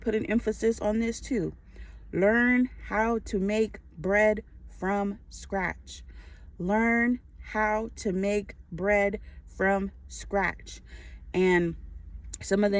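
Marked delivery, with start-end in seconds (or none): 2.1-6.0 very slow, word by word; measured, emphatic instruction with spaced-out words; slow emphasis. Real, not acted. Repeats key instruction for emphasis. 6.6-10.8 very slow, word by word; measured, emphatic instruction with spaced-out words; slow emphasis. Real, not acted. Repeats key instruction for emphasis.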